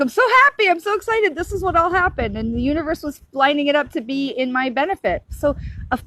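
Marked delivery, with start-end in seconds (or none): 0.0-2.4 high, loud, thrilled husband; much higher, louder happy gush; excited husband. Retold or imitated. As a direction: imitate him bursting with joy on the phone. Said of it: "He's like".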